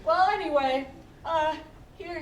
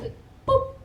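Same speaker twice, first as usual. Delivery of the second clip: popping sound; 10 semitones higher, 3 dB louder than usual. Acted. Mimics balloon popping in improv.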